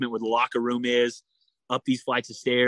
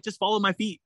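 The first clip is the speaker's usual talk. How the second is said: higher, light mock of Laura calling out; imitating Laura; 8 semitones higher than usual. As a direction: swimmer echoes her teammate's easy call, voiced higher. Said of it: "She's like".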